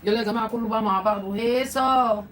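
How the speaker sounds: loud, rousing party call; festive cheer. Real, not acted. To the viewers, calling everyone to celebrate, loud and festive.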